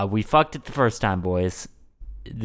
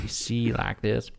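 ordinary talk

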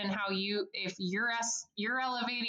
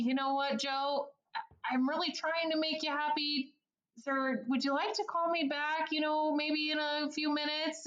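polite advisor to irate caller; 6 semitones higher than usual. Shown as a demonstration. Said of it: "it may be".

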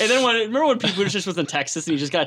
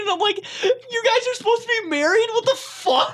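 high-pitched, squeaking disbelief; comic incredulity. Retold or imitated. Comic reacting to an awkward kiss, high incredulous squeal. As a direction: climb way up high in mock outrage.

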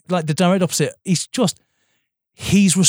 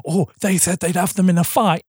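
pitched-up sneering mock of the feud; mocking quote; 4 semitones higher than usual. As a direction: scoff at the petty fight, higher voice. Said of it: "It was like".

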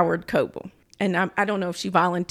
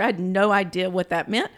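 ordinary talk, nothing marked

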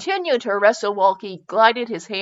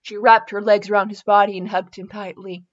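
ordinary talk

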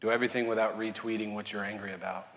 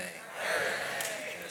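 Everything drawn out slow and soft with a New Orleans Cajun lilt; a playful Cajun-accented Everything; Cajun-flavored everything; 8 semitones lower, 8 dB quieter, much slower than usual. Shown as a demonstration. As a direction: give Everything some Cajun salsa, slow and breathy. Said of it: "Put a little salsa on it".

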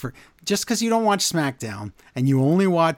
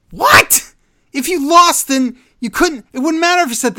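incredulous rant; 12 semitones higher, 7 dB louder than usual. Real, not acted. Ranting at bad wrestling writing.

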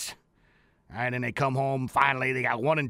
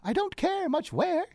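Alice voice; 14 semitones higher than usual. Retold or imitated. Alice quoted in story.

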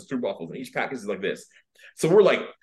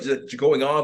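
usual speaking voice

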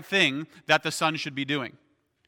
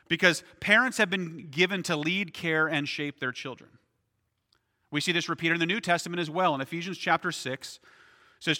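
normal talking voice

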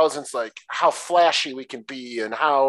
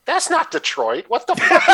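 pitched up, laughing disbelief; indignant yelp breaking into a laugh; mock outrage; 11 semitones higher, 5 dB louder than usual. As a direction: cry out at the screen in mock outrage. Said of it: "I'm pointing out things going".

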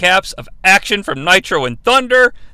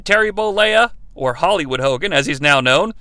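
ordinary delivery

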